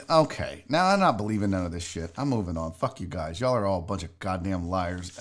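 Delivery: very low, soft grumbling; dismissive grumble. Real, not acted. Host gives up on the chat's tall tales, grumbling dismissively. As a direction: drop low and quiet, fed up.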